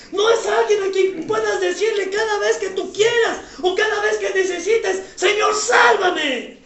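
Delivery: high, loud, emphatic sermon delivery; preaching at full force. Real, not acted. Pastor hammering his point to the church, preaching loud. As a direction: preach it hard to the congregation.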